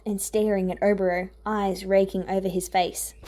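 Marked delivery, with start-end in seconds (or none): none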